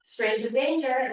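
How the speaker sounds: slow, loud safety slogan; crime dog slogan. Acted. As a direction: announce it like a police mascot. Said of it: "like she's been roughed with a crime dog".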